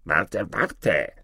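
lightly voiced frog calling after someone; a hint of a pleading frog. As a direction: call for her to wait, as the frog. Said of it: "rief der Frosch".

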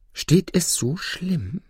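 slow, high-pitched devil. Acted. Devil reacting to the judge's threat, slow high question.

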